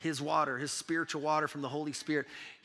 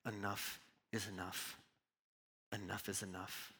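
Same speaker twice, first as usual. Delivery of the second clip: much softer and lower, worn out; hushed resignation; 7 semitones lower, 12 dB quieter than usual. Acted. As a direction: say it like someone at the end of their rope. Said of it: "You will say".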